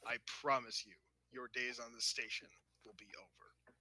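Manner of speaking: low, soft, controlled warning; stern officer. Acted. Officer warning Klingon who threatened subordinate, quiet warning. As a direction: warn them quietly and firmly.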